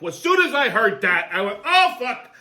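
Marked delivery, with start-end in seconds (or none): 1.6-2.3 high, startled "oh, fuck"; a surprised curse at the bad news; shocked outburst. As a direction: blurt it out in shock. Reacting on hearing the news.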